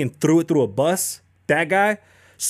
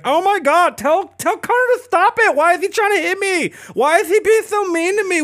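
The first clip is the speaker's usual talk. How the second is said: very high, squeaky mock whimper; mock him as a frightened crybaby; 4 dB louder than usual. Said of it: "He's like".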